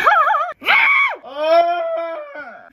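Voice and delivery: high burst of laughter; loud laugh. Real, not acted. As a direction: crack up loudly. Laughs after the line.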